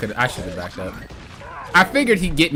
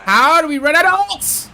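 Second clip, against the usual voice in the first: high, loud outraged cry; furious shout; 8 dB louder than usual. Real, not acted. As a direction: yell it at the team in disbelief. Angry at team running out of ults.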